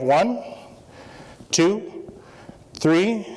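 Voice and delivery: each number rising, with a pause after; spaced-out count with rising tune; counting out loud. Shown as a demonstration. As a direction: count off slowly for the room, lifting each number. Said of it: "I'm gonna count out loud".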